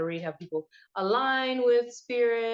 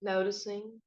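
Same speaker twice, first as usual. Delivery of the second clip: guided meditation; 9 semitones higher than usual. Acted. Host guiding listeners to find their breath, meditation voice. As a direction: lead listeners inward, leaving space after each prompt.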